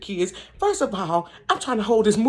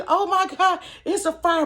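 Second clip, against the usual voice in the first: high mock-alarmed old woman; a startled old neighbor, pitched well up; imitating the neighbor's fright, higher; alarmed old lady; 9 semitones higher than usual. Old neighbor realizing fire.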